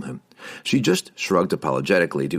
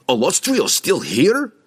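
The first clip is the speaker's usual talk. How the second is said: slow, high, louder gruff dwarf; abrupt dwarf; 11 semitones higher, 4 dB louder, slower than usual. Acted. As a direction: cut him off sharply. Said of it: "Bruner interrupted".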